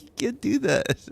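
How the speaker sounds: high, drawn-out amused protest; slow, squeaky mock scolding; retold exasperated parent.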